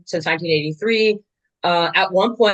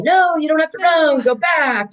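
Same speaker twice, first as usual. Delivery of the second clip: high-pitched mock yell without much volume; retold coach's yell; 9 semitones higher than usual. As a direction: imitate a coach calling out urgently to a runner. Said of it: "the coach is yelling".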